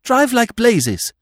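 put-on a young man urging the chauffeur to hurry, high and loud; urgent order. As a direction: bark it at the driver. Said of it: "said Freddy to the chauffeur".

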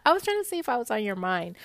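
quick mocking echo of his words; rapid, ridiculing imitation; mocking quote. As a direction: parrot it back fast, in disbelief. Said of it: "who says that?".

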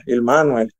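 "Manuel" stressed on the A, MA-nuel; Italian-style stress. Retold or imitated. Player recalling his name in Italy, "Manuel" stressed on the A. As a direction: say "Manuel" with first-syllable stress. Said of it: "lo ponen en la A".

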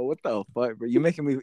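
ordinary talk, nothing marked

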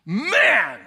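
spoiled cat greeting the owner; demanding cat. Acted. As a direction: open the cat's voice with one word. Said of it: "The cat says this to the owner".

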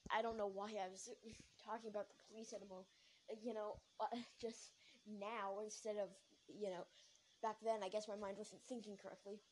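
normal talking voice

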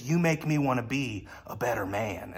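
a lightly voiced bad Jack Nicholson, low and soft; terrible Jack Nicholson. Pastor doing his bad Jack Nicholson impression, low and soft. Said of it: "that's a terrible impression".

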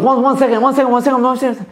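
high-pitched imitation of a stalling tradesman; mock flustered stalling. Retelling contractor stalling over his quote, mock flustered.

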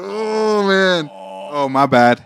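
retold flustered apology after being told his fly is down; embarrassed surprise. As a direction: caught off guard in front of the class. Embarrassed reaction, retold in class.

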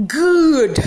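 short loud burst on the one word; emphatic good. Acted. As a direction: bark it out, defiant and pleased. Insisting the papers tasted good.

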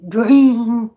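a hint of dreamy swoon. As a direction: breathe the word out dreamily. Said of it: "a dream impression".

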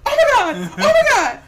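high-pitched, laughing mock panic; panicked excitement. Viewer retelling Arya's big moment, high frantic squeal. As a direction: squeal it like an overwhelmed fan.

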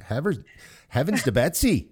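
high-pitched Minnesota folksy exclamation, a hint of Fargo; hammed-up Minnesota accent; mock Minnesota accent. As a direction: chirp heavens to Betsy like a Minnesota aunt. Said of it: "starting to talk like this".

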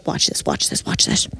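hushed whisper, letting friends in on it; mimicked whisper. Friend whispers to Sarah before hitting on a guy, hushed.

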